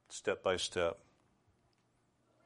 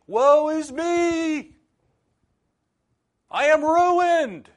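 full-force high shout, drawn out; terrified shouting; 14 dB louder, much slower than usual. Acted. Isaiah in terror before a holy God, shouting. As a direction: scream it out in terror, very high and loud. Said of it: "he was probably shouting".

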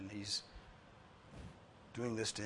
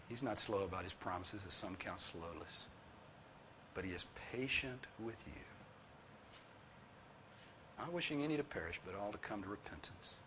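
softer, gentle reading of scripture; tender quoting; 4 semitones lower, 9 dB quieter than usual. Real, not acted. Preacher quoting Peter to the congregation, soft and tender. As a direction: say it softly, with care.

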